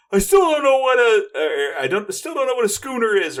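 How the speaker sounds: high, loud shouted quote; mock movie shout. Host quoting a Mallrats character with a high loud shout. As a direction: bellow the line like the Mallrats guy. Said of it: "'cause he's like".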